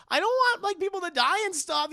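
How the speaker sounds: high, loud imitation of a protest; hammed-up squeal of objection; mock protest. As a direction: pipe up in an exaggerated high voice. Retold quote, protesting to Dewey.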